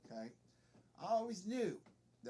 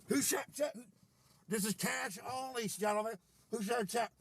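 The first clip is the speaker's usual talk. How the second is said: high, loud demand; mock outraged challenge; 3 semitones higher, 4 dB louder than usual. Commander challenges gentlemen over who said check, high mock shout. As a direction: call it out to the whole squad.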